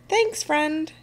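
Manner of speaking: high-pitched called-out thanks; friendly yell. A narrator's reading. Captain to the helpful sea monster, yelling. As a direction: call thanks over to a friend. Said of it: "yells Captain Pete".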